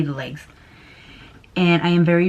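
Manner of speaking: usual speaking voice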